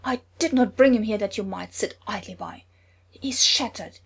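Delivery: loud angry tirade. A narrator's reading. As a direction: keep roaring at the healer in fury. Thor continues angry tirade.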